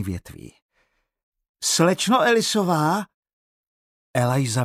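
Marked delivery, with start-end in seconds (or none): 1.6-3.1 calling loudly across the bush. Acted. Searcher calling for the teacher in the woods, loud call. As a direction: shout her name, searching.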